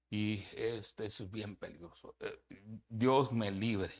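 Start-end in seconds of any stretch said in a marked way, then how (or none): none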